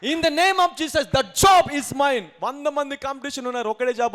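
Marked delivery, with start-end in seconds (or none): 0.1-2.2 slow, loud and higher proclamation; shouted declaration. Shown as a demonstration. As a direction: shout it slowly like a victory cry. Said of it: "ఏమరిచారు?". (గట్టిగా, నెమ్మదిగా అరుస్తూ ప్రకటించు)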